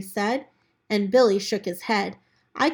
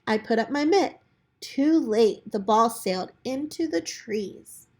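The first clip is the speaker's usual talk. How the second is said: usual speaking voice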